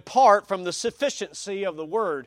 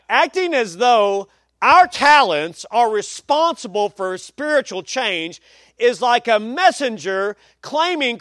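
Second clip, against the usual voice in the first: loud, raised preacher's delivery; forceful quote reading; 6 semitones higher, 8 dB louder than usual. Real, not acted. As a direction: drive the quotation home from the pulpit.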